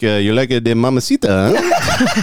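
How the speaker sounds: put-on light Cuban accent, teasing rise at the end; a hint of Cuban accent, leering; imitating a Cuban driver. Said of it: "He's like".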